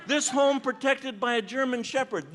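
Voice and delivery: approving recitation of a warning sign; proud sign reading. Retold or imitated. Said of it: "That's a dog".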